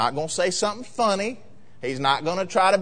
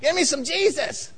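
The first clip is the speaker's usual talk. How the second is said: mock-eager worshipper, pitch leaping at the end; hammed-up church enthusiasm; gushing Sunday churchgoer; 4 semitones higher than usual. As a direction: imitate a showy Sunday-morning enthusiast. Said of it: "lifts up holy hands on Sunday morning and says".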